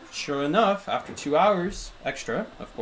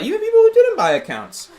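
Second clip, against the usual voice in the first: suddenly much higher and louder; excited outburst; 9 dB louder than usual. Real, not acted. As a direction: burst out with heated emphasis. Excited rant about covid ranking.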